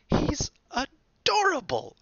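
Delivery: high, slow cooing mother; high slow doting mother voice; doting Mama. A narrator's reading. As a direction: coo over the baby like a besotted mum. Mama coos over baby wolf.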